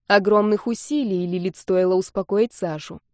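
plain reading voice

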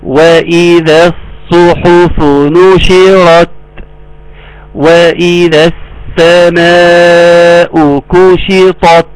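slow, measured tajweed chant; Quran recitation. Shown as a demonstration. Teacher reciting verse after verse for students, slow recitation. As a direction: hold the long vowels and nasal noon.

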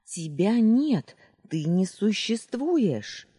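high, loud and slow; desperate shout. Acted. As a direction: cry out each word. Heroine keeps shouting at villain.

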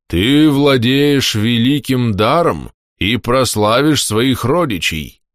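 slow, weighty prince's voice; meaningful. Acted. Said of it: "со значением произнёс князь". (значительно, медленно, веско)

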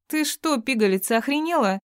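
high, squeaky outrage; indignant squeak. A narrator's reading. Woman to the girl who insulted her, indignant high squeak. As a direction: squeal it as an affronted little madam. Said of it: "пискнула эта дамочка".

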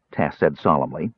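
ordinary delivery

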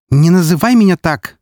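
high, sharp retort; irritated snap. A narrator's reading. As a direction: snap back at the hated nickname. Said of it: "огрызнулась Альфарка". (огрызаясь, высоко и зло)

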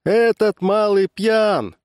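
high, slow drawn-out cry. A narrator's reading. Barker denouncing the drunk heckler, crying out. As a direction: call it out to the whole crowd. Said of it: "крикнул зазывало".

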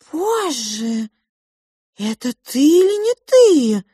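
high-voiced astonished mouse; shocked exclamation. Pink mouse seeing the girl's changed face, shocked. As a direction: gasp out disbelief in a high voice.

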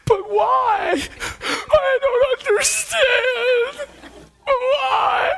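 high, loud tearful wail; waitress crying as she runs up with the receipt; crying plea. Retold or imitated. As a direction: sob it out, high and broken. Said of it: "trembling uncontrollably".

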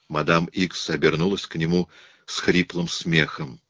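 usual speaking voice

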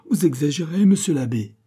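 acted indignant protest; beside himself. A narrator's reading. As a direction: object in exasperation. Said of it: "jeté hors de lui-même".

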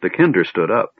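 ordinary delivery, nothing marked

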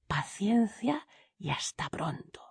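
slow, secretive whisper. A narrator's reading. Servant to each head hidden in a jar, whispered. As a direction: whisper it slowly, keeping them waiting.

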